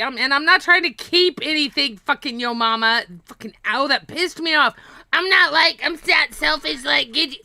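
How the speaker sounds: high, loud, worked-up protest; annoyed rant. Real, not acted. Defending oneself after getting pissed off, heated rant.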